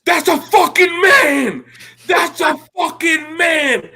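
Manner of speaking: high, slow, drawn-out yell; high pitched incredulous shout; retold shout. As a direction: yell it across a packed restaurant. Retold yelling in restaurant.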